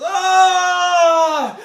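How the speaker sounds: huge high roar breaking into laughter; mock battle roar. Mocking Conan roaring over his ruined village. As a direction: bellow at the sky, laughing. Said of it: "and he goes".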